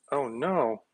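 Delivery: ordinary talk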